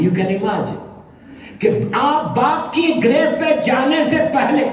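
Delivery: ordinary delivery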